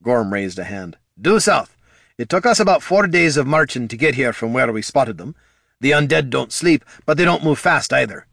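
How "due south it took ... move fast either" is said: higher, louder character voice; lightly voiced adventurer reporting; matter-of-fact adventurer. A narrator's reading. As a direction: report it briskly and plainly.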